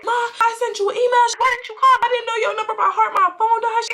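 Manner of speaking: very high, whiny rush; acting out a flustered back-and-forth with mom; imitated frantic pleading. Daughter and mom on the phone, mock frantic.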